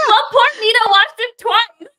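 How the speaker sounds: high, loud laughing tease. Real, not acted.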